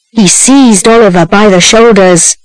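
plain reading voice

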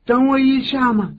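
put-on high pleading little boy; whiny child. Son begging his father to wait, high childish whine. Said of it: "儿子就说".

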